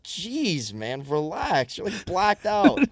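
imitating own incredulous protest, pitch climbing; exasperated comeback. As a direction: tell off a drunk accuser, half amused. Retold exasperated reply to drunk accuser.

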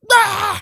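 very high, loud mock scream; short hammed-up scream; attack yell. [name] flings potpourri at the dad, mock attack yell.

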